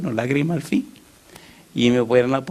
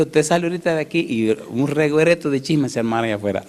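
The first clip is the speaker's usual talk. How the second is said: usual speaking voice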